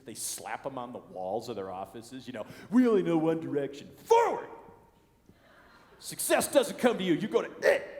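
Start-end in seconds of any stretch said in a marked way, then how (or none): 2.7-7.9 mock motivational slogan. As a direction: read the wall posters like a cheesy rally cry. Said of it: "companies who put these motivational slogans".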